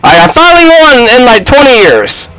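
high, elated victory cry; triumphant. Real, not acted. Player wins at last, triumphant. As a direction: crow over the winning shot. Game-winning shot goes in.

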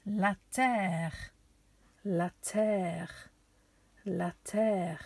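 "La terre" said in French, la TAIR; French pronunciation. Shown as a demonstration. Teacher giving children the French for earth, "La terre" pronounced la TAIR. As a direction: model "La terre" with open e and French r. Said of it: "the word for the earth".

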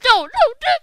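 very high, loud "no"s; frantic high-pitched cries; panicked scream. Real, not acted. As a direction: scream in alarm as you are attacked. Attacked, says he is screwed.

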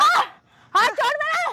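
high pained protest. Real, not acted. Daughter-in-law tells the bystander to release her hand, high pained protest. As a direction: let go of me, said high and urgent.

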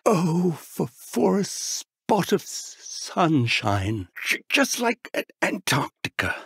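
stuttering through chattering teeth; shivering with cold. Acted. Professor frozen by an ice spell, shivering stutter. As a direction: stammer as if frozen stiff.

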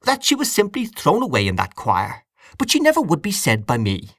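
high, insistent aunt; indignantly emphatic. A narrator's reading. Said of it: "said Aunt Kate emphatically".

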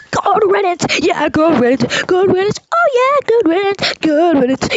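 sing-song crowing, rising high; gleeful gloating. Acted. Fan cheering Belle's exit, gloating.

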